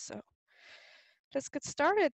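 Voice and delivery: ordinary delivery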